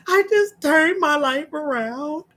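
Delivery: a caller tearfully thanking the radio host; acting out crying caller. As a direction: sob the story out like the caller did. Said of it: "so I'm gonna act her out".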